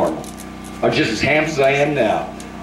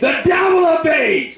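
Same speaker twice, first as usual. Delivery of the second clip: high, shouted renunciation, much higher; shouted prayer; slower than usual. Real, not acted. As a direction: cast it out at the top of the voice.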